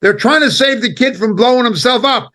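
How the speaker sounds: usual speaking voice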